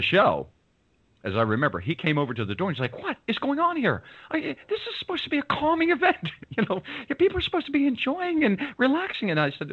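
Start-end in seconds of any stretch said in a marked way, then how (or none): 3.0-9.3 high, huffy mock scolding; indignant host. Event organiser scolding the guests for ruining his calm party, high and indignant. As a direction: do the fussy host who wants calm.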